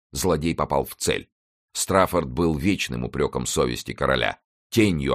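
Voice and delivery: ordinary delivery, nothing marked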